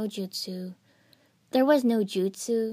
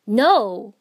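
loud, raw cry of no; shout; 12 dB louder than usual. A narrator's reading. Naruto shouting as Yukie sacrifices herself, loud raw cry. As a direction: belt it out in panic. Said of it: "he shouted".

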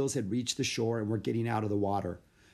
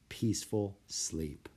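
plain reading voice

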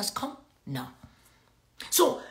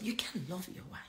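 hushed whisper; 4 semitones lower, 15 dB quieter than usual. Real, not acted. Preacher drops to a hushed point.

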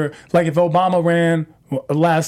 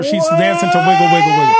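higher and louder, building up; excited shout; 13 semitones higher, 6 dB louder than usual. Real, not acted. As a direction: burst out excitedly. Excited about the dance video.

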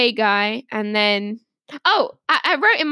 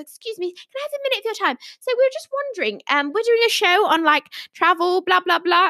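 high, quick voice of a woman approaching; imitated polite stranger; 5 semitones higher, faster than usual. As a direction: mimic a chirpy interviewer asking for a minute.

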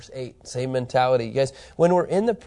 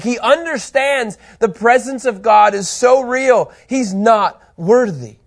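loud, high-pitched, emphatic preaching; impassioned preaching; 7 semitones higher, 8 dB louder, slower than usual. Acted. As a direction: preach it with rising force, punching key words. Preaches with raised force.

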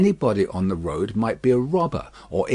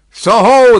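high, very loud sung-out hail; guard's roaring challenge; full roar; 11 semitones higher, 13 dB louder than usual. A narrator's reading. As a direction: bellow it into the distance. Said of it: "sang out as loud as he could roar".

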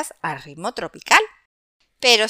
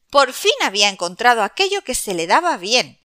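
plain reading voice

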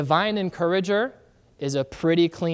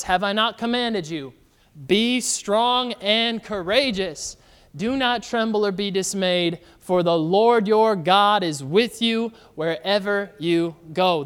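scripture reading; 2 semitones higher, 4 dB louder than usual. Acted. Pastor reads scripture aloud.

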